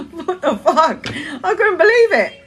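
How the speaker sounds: high laughing disbelief. Real, not acted. Laughing in disbelief at the panic buying. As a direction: laugh it out, incredulous. Laughing at toilet paper prices.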